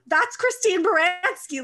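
loud, slow starstruck gasp of a name; excited exclaim. Retold or imitated. Fan spotting Christine Baranski on screen, excited exclaim. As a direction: blurt it out, star-struck.

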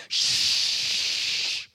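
sustained voiceless rush of breath; long explosion hiss. Acted. Preacher sounds the blast spreading, long breathy rush. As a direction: let the blast wash out in a long "shh".

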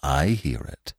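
low, quiet, unmoved; old stag. A narrator's reading. Old stag answering excited Bambi, low and calm. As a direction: acknowledge it deep and softly. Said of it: "said the old stag".

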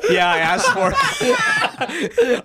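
high, loud, breaking into laughter; can barely get it out for laughing; laughing hard. Real, not acted. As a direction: friends cracking up over a tandem joke, laughing. Joking about tandem skydive.

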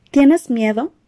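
ordinary delivery

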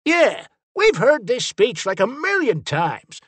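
high-pitched, fed-up jeer; exasperated heckle. Acted. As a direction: cut the villain down, bored of the speech. Heckling the villain's speech.

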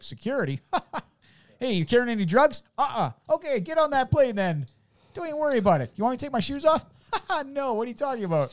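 high, loud breezy mock guard voice; lax security guard. Friend mocking lax seventies airport security, high and breezy. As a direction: play a carefree airport guard waving people through.

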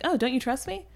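mock innocent, lifted tone; villain faking sincerity; earnest-sounding. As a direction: play it sincere so it slips by. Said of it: "actor delivers it ... actually earnest".

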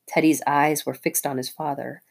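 ordinary delivery

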